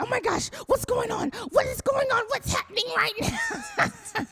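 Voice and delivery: panic-shout what's going on; frantic excitement. Retold or imitated. Sister Kim demanding to know what's happening, high shouting. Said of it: "She's like".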